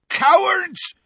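high, furious bellow. A narrator's reading. Hagrid to the wizards who felled the teacher, bellow. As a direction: bellow it at the attackers. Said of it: "bellowed Hagrid".

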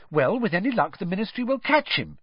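high-pitched, fervent hope. A narrator's reading. Hermione hopes the Ministry catches him, fervent. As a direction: wish it earnestly. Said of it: "said Hermione fervently".

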